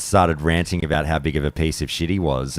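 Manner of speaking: normal talking voice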